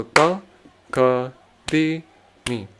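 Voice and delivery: ta, ka, di, mi spaced out with a pause between pairs; one syllable per count. Shown as a demonstration. Teacher counting out rhythm syllables, one per beat, spaced. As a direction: lay each syllable on its own beat, unhurried. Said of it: "I'm just going one syllable per container".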